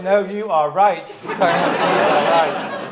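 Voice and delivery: high, slow, laughing reassurance of a wrong belief; mock self-assured inner voice; stubborn inner voice. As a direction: drag out the insistent inner voice, amused. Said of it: "But some voice like".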